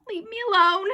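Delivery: high, loud frightened plea; quivering voice. A narrator's reading. Boy begs the ghost to go, quivering plea. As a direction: plead high and scared. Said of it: "my voice quivering".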